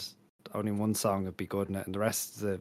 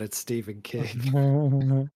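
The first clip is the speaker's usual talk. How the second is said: dramatic announcer; 5 semitones higher, 6 dB louder, much slower than usual. Pretend. Joking announcement.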